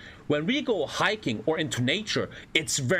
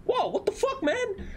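mock amazement; slower than usual. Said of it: "And I'm like".

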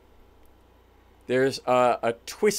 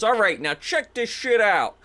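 higher-pitched boast before a big reveal; cocky showman hype; 7 semitones higher than usual. Acted. Jimmy goes out to the crowd, showing off. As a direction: hype up the trick.

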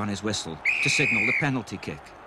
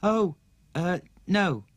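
put-on higher, halting child voice; hesitant boy; 7 semitones higher, 3 dB louder, slower than usual. Stanley answering grandma, hesitant. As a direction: fumble for an answer. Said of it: "said our Stan".

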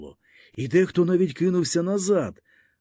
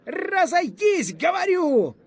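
high-pitched, drawn-out officer's order; frightened, angry shout; slower than usual. A narrator's reading. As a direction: shout a scared, furious command, pitched far up. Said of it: "перелякано і злісно закричав офіцер". (переляканий і злісний крик)

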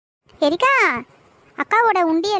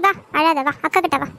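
lower, gentle coaxing; tender comfort; 6 semitones lower than usual. Acted. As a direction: soothe a crying little sister. Elder sister comforting crying sibling. (அழும் தங்கையை அன்பாக சமாதானப்படுத்தும் அக்கா)

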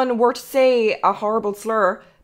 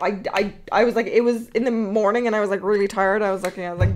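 ordinary delivery

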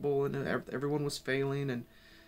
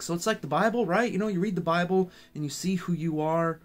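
higher, louder, eager friend voice; 4 semitones higher, 5 dB louder than usual. Retold or imitated. Friend mentioning the Bible mirror idea, eager raised voice. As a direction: pitch it up like an excited friend. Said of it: "He's like".

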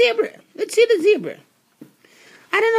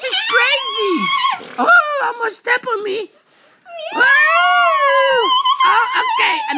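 startled cry; 9 semitones higher, much slower than usual. Real, not acted. Host nearly trodden on by the horse, startled cries. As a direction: yelp as the horse nearly steps on you. Horse almost steps on him.

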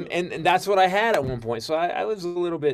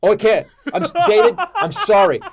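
panicked apology; 6 semitones higher, 11 dB louder than usual. Retold or imitated. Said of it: "I got cold and sweaty".

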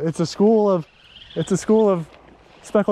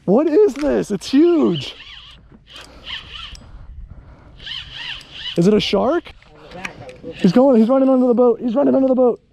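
fast, high, urgent excitement; racing words as the fish runs; excited; 7 semitones higher than usual. Real, not acted. Fish runs under the boat.

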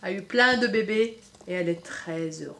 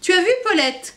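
much higher, louder duck voice; duck quacking; 8 semitones higher, 8 dB louder than usual. Acted. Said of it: "cancana Jeanne". (la cane Jeanne, voix aiguë et forte)

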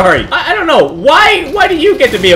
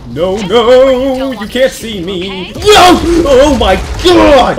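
panicked shouting; 5 semitones higher, 5 dB louder, much slower than usual. Real, not acted. Player chased by zombies.

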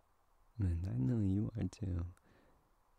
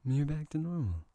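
surprised; 7 semitones higher, 4 dB louder than usual. Acted. Sudden surprise at change.

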